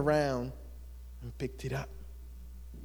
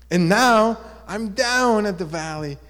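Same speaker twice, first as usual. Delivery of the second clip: louder, higher aggrieved protest; complaining; 6 semitones higher, 6 dB louder than usual. Acted. Fallen rock laments losing its view, complaining. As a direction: protest your fall as the grumbling rock. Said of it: "the rock was complaining".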